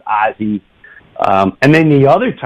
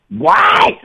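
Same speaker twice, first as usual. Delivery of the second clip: high, rising yelp of shock; stunned disbelief; 12 semitones higher, 4 dB louder than usual. Retold or imitated. Passenger learning whose jet it is, stunned high cry. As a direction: re-enact a jolt of disbelief.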